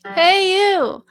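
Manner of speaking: loud speech-like chest call; speaky chest call. Shown as a demonstration. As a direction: call out hey you from the chest. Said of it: "this kind of speaky feeling".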